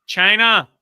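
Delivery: a loud high heckle from the crowd; shouted out. Retold or imitated. Crowd member yelling out at the gig, loud. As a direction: heckler shouting from the front row.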